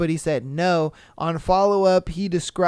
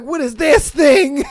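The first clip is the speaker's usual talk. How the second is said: much higher, loud shout; hammed-up appalled parent; mock horrified question; 8 semitones higher, 6 dB louder than usual. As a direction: gape at the strange thing. Parents finding the toys.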